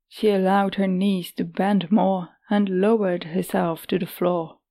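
plain reading voice

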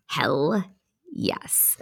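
soft, dropping to a whisper; hushed triumph. Real, not acted. Storyteller relishing that she did it, hushed triumph. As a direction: let it sink away into a whispered yes. Savouring her feat, hushed.

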